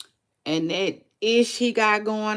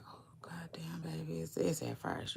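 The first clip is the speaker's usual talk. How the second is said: much softer hushed retelling; whispered reaction; 14 dB quieter than usual. Retold or imitated. Retelling her shock about the baby in a whisper. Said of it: "whispered retelling again".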